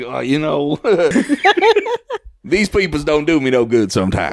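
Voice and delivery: laughing innkeeper. Acted. Innkeeper laughing to guests about his blind spots. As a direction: chuckle out the complaint. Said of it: "He's like".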